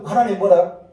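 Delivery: ordinary delivery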